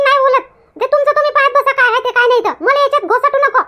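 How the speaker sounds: a put-upon friend snapping back over the phone; annoyed outburst. Acted. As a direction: refuse her, fed up and half laughing. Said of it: "बरं आहे का एवढी ओरडतेस ग?".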